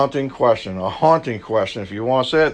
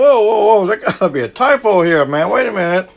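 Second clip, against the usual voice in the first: mock surprise; 6 semitones higher than usual. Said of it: "I looked at that and I was like".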